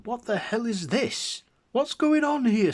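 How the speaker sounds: very high, loud alarmed cry; shocked onlooker. A narrator's reading. Museum visitor seeing monsters appear, shocked high cry. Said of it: "People gasping, going".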